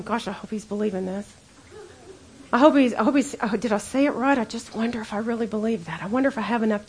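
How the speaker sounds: fretful second-guessing, mostly under the breath; doubting inner voice. Pretend. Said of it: "the other part of you is going".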